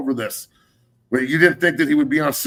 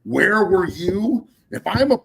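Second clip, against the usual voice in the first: fired up; 5 semitones higher than usual. Real, not acted. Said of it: "I'm getting a little fired up".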